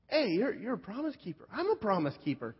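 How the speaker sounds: high, bright-eyed gushing coworker; eager Billy voice. Retold or imitated. Billy beaming at the new guy over his hat, high eager voice. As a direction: pitch it up like a proud, beaming church man.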